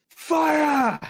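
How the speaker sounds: high, very loud battle cry; shouted command. Acted. Hobgoblin orders the catapult to launch, full shout.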